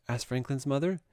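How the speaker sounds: usual speaking voice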